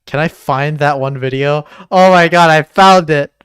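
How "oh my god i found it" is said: loud, thrilled outburst; excited discovery. Real, not acted. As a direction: burst out loudly at a lucky find. Finds the video being searched for.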